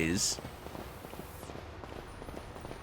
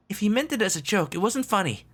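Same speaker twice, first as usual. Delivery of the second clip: put-on high young character voice; 12 semitones higher than usual. Kid reacting after scare.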